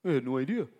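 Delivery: mocking his own false excuses. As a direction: play dumb in a mock innocent voice. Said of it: "I've not been confused".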